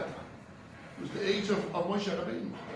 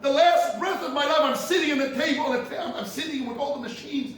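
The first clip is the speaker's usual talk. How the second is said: higher, louder deathbed lament; mock tearful outcry; 6 semitones higher, 5 dB louder than usual. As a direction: act out a dying rabbi's anguished cry. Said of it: "He started to cry".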